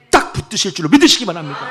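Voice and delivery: loud declaration with a falling end; rousing sermon cry; preaching shout. Acted. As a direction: proclaim it with full force.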